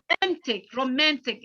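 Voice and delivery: "romantic" stressed on MAN; stress on second syllable. Shown as a demonstration. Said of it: "in the second one".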